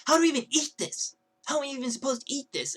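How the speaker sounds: high-pitched mocking whine; bitchy complaining. Girl griping to the guy about eating it, bitchy whine. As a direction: mimic her indignant griping. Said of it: "she just keeps bitching and complaining".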